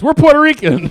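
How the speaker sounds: very high, loud mock shout; hammed-up yell as the punchline; mock outcry. Co-host voices the mom topping the claims, mock shouting. Co-host tops the riff as mother.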